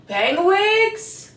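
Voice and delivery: rising, eager question; excited question. Real, not acted. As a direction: ask it brightly. Excited repeat of product name.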